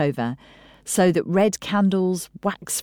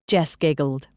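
usual speaking voice

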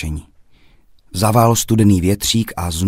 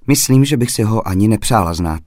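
ordinary delivery

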